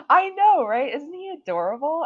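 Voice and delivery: high, loud, delighted squeal; excited gushing. Real, not acted. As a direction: gush over something adorable. Gushing over cute timer.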